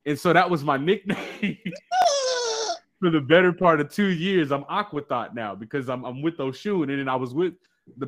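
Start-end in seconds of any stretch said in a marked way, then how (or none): 1.6-2.8 squealing burst of laughter; high laugh. Real, not acted. Laughs at own nickname.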